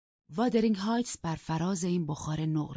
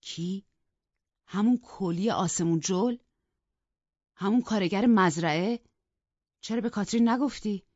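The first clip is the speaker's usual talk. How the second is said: rising, louder disbelief at each question; astonished; 3 semitones higher, 6 dB louder than usual. A narrator's reading. The husband to the maid, astonished and louder. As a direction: caught off guard, growing indignant. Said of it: "با حیرت گفت".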